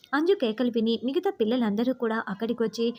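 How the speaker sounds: ordinary delivery, nothing marked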